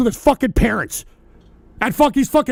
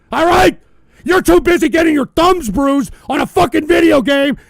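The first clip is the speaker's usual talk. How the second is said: angry shouting; 3 semitones higher, 4 dB louder than usual. Real, not acted. Streamer raging at a lazy chatter, furious shout. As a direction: blast him with a yell.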